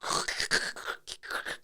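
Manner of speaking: quiet, voiceless crackling noise; imitated ice cracking. Co-host mimics the lake under a truck, crackling noise. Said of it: "hearing it go".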